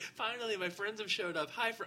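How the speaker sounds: high, cheerful imitation of a friend; lightly voiced happy friend; imitating friend. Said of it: "He's like".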